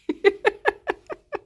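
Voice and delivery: high squeaky burst of laughter. Real, not acted. Streamer laughing at her own missed swears. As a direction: laugh at yourself.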